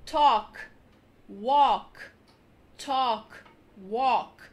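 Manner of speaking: no l in talk and walk; silent l. Shown as a demonstration. Teacher modelling the right talk and walk. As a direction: talk and walk said as tawk and wawk. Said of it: "O correto é".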